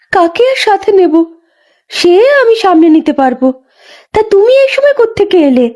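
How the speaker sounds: put-on high, light young woman's voice; a pregnant young wife, lively and a bit weary; young village wife. Young Ganga tells the old midwife about the far pond, in a high young voice.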